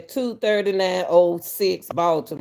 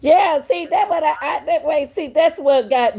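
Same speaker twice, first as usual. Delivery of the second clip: high, eager breaking-in; excited interjection; 8 semitones higher than usual. Real, not acted. Host spotting what bothered her in the clip, excited. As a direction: jump in high, seizing on the detail.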